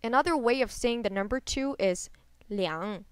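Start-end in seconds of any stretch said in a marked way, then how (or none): 2.5-3.0 liang dipping, then rising; falling-rising tone on liang. Shown as a demonstration. Teacher introduces another word for two, falling-rising liang. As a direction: dip liang and lift it at the end. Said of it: "This is a falling rising tone".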